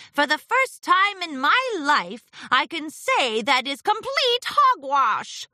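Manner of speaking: high, emphatic exasperation; sighed. A narrator's reading. Discord dismissing the town's complaints, exasperated. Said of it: "Discord sighed".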